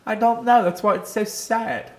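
high put-on European accent; mock-sad fake European accent. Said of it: "What is this voice?".

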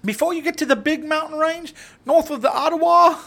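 a hammed-up Canadian from up north; mock Canadian accent. Telling Justin where he lives, in a mock Canadian accent.

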